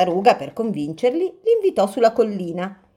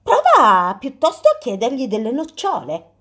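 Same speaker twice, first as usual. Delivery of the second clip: lightly voiced tortoise, higher and cheerful; 6 semitones higher, 5 dB louder than usual. Tortoise advising bear.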